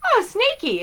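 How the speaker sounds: put-on high, loud baby-talk coo; cutesy coo. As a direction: coo at the snake like a pet. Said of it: "I literally was like".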